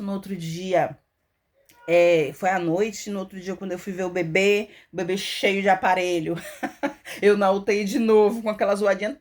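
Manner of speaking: ordinary talk, nothing marked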